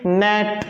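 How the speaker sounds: net with short e; English example word. Shown as a demonstration. Teacher demonstrating नेट with a short e. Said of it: "जैसे".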